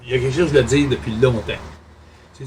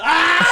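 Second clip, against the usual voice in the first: high loud shriek breaking into long laughter; shriek, then crack up helplessly; sudden scream-laugh; 6 dB louder than usual. Pretend. Host cracking up during the ugly-face countdown, shrieking laughter.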